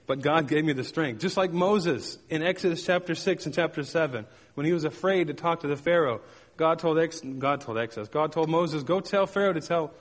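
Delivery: ordinary talk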